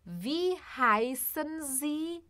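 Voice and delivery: slow, careful German question; teacher modelling German slowly; slow model phrase. Shown as a demonstration. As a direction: spell it out slowly for learners. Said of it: "vamos aqui para as primeiras perguntas".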